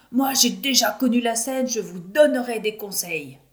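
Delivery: high, loud eager promises; eager plans. A narrator's reading. Children eagerly promising the director how they'll do it. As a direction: sell the plan with excitement.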